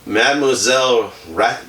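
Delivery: ordinary delivery, nothing marked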